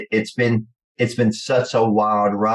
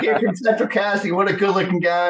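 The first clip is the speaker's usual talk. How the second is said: high, admiring impression of his mentor; mentor's gushing voice; 10 semitones higher than usual. Mentor praising the young man in a suit, high gushing voice. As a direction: gush over the kid in a high put-on voice. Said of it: "And he's like".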